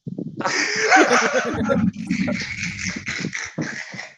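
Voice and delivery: high breathy unvoiced whimper-laugh; imitating Suave crying pretty; mock bougie cry. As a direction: fake a dainty sob.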